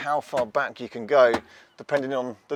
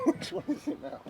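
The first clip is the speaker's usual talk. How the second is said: laughing; 10 dB quieter than usual. Real, not acted. Confused by levers, laughing.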